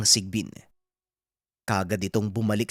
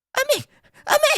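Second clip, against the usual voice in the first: mother's high-pitched, loud cry of alarm; alarmed shouting; 7 dB louder than usual. Acted. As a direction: call out to your son in panic, very high. Mother rushes out at son's scream. (natatarantang sigaw ng ina, napakataas ng boses)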